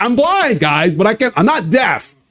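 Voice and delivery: indignant retort. Acted. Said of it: "I'm like".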